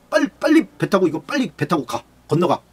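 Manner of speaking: high, urgent hurrying call. Acted. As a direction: rush them onto the boat, pitched high. Jesus urgently hurrying disciples. (제자들을 배에 태우며 다급하게 재촉하는 높은 목소리)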